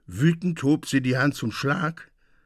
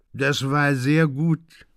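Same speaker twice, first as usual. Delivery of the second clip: put-on drunk; 3 semitones higher, 4 dB louder, slower than usual. Drunk Delphin repeats.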